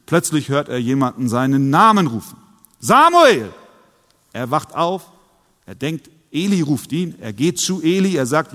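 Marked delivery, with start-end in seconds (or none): 2.8-3.5 a loud call of the name across the dark; loud summoning call. Acted. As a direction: call him from afar, rising then falling. God calls the sleeping boy.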